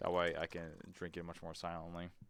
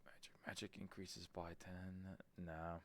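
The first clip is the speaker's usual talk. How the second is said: whisper; 14 dB quieter than usual. Real, not acted. Reading menu under breath.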